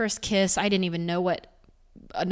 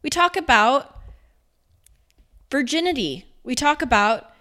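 higher, louder, insistent; emphatic; 6 semitones higher, 5 dB louder than usual. Real, not acted. Urging viewers about intimacy, with passion. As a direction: press the point with passion. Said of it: "impassioned point".

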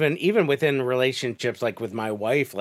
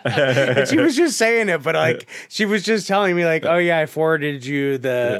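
laughing; 6 semitones higher, 6 dB louder than usual. Real, not acted. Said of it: "laughing retelling wife".

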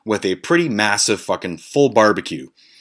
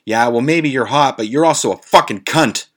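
imitating an angry outburst, higher and louder; set-off friend; 6 semitones higher, 6 dB louder than usual. Friend snapping at the girl at the pool, angry retort. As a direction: act out a friend losing his temper. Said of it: "something fucking set Tone off".

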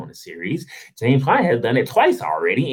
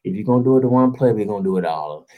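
normal talking voice

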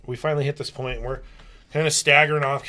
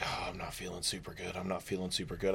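much lower and much softer, sick on the ride; queasy murmur; 6 semitones lower, 15 dB quieter than usual. Retold or imitated. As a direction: barely get it out, about to be sick. Feeling sick atop Ferris wheel.

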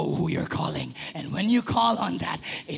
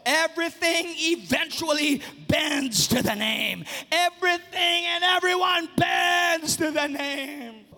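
high preached shout; preached proclamation; 8 semitones higher than usual. Acted. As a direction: proclaim it to the crowd. Preached line, then repeated.

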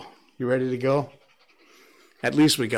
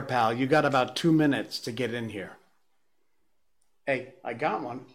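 ordinary talk

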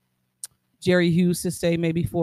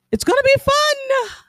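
excited hype; 4 dB louder than usual. Real, not acted. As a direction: hype it up, voice leaping high. Playful hype about the episode.

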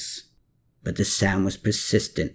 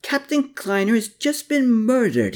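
Klaus bursts in with the news; hollered; 12 semitones higher than usual. A narrator's reading. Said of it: "he hollered".

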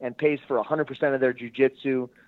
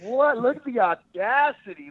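high incredulous what, then look at the audacity; blown away; 9 semitones higher than usual. Real, not acted. Retelling Gustavo's arrival, high incredulous disbelief. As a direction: disbelief at the nerve, pitched up. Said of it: "I was just blown away".

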